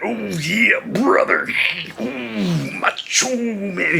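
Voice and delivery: slow, raspy mock promo voice; hammed-up Macho Man promo. Shown as a demonstration. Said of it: "cutting a promo".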